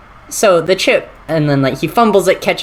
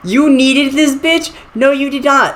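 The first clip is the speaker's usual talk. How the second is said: mocking high voice; 8 semitones higher than usual. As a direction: scoff at him in a high voice.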